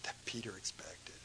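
a hushed whisper for effect. Real, not acted. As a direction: drop to a whisper.

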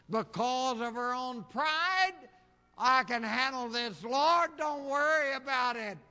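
high, loud, soaring sermon cry; preaching at full force. Acted. Preacher pleading with the congregation, preaching at full force. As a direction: preach it out, voice climbing and ringing.

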